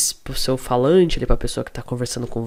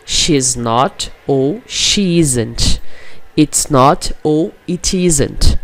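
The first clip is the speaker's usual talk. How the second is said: slow and louder, each contraction spelled out by ear; slow pronunciation drill; 6 dB louder, much slower than usual. Shown as a demonstration. Teacher sounding out contractions slowly for students to repeat. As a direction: drill it slowly and clearly for learners. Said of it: "vamos pegar a pronúncia aqui".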